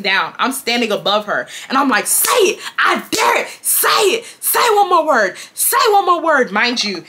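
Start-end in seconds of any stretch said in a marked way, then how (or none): 2.1-6.5 high, forceful repeated challenge; confrontational, high-pitched goading; angry dare. Retold or imitated. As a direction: dare them, pitched up and insistent. Acted dare standing over her opponent.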